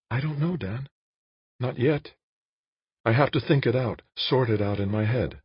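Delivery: high woman's character voice, hesitant; Jane, uncertain. A narrator's reading. Jane tells Dan she must think, high uncertain voice. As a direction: voice the woman high, asking for time. Narrator voices Jane's dialogue.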